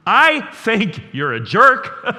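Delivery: loud, slow accusing jab; hammered-out accusation; accusatory. Shown as a demonstration. Pastor demonstrating the wrong, accusing way to speak. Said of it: "you don't say".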